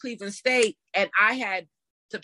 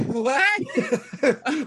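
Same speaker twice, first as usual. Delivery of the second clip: higher, laughing mock "what?"; mock disbelief; 5 semitones higher, 3 dB louder than usual. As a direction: imitate people's amazed reaction. Acting people's shock at prize money.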